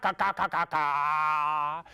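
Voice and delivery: rapid crow caws drawn out at the end; crow cawing. Acted. Storyteller voicing the crow in the tale, cawing. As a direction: caw like a crow.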